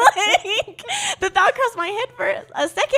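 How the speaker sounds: real giggles breaking into the line; laughing. Real, not acted. Laughing at her own thought.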